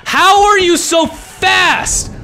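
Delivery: high, loud and slow scream; desperate furious yell. Real, not acted. Gamer screams at Jack for being too fast, furious slow yell. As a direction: howl it at the monster in despair.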